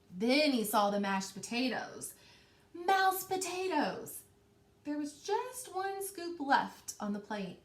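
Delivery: rhythmic piling-up read-aloud voice; story narration. A narrator's reading. Teacher reading the growing tower to her class, rhythmic storytelling. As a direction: build the stack step by step for the kids.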